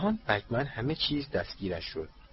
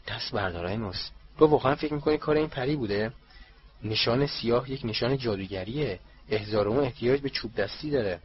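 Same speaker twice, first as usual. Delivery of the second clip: usual speaking voice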